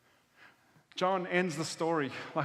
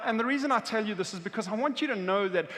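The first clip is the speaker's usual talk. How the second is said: ordinary talk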